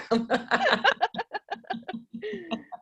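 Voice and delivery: high bubbly laugh of relief; delighted laugh. Real, not acted. Client laughing happily at coach's encouragement, bright laugh. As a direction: laugh it off, thrilled and a little embarrassed.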